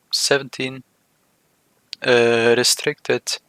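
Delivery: ordinary delivery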